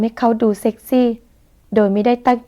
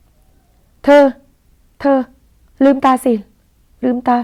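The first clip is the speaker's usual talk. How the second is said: urgent call; 3 semitones higher, 4 dB louder, much slower than usual. Acted. Daniel urging unconscious Narin awake.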